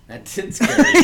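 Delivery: high, loud laughing words. Real, not acted. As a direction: crack up while saying it. Both laughing after the scare.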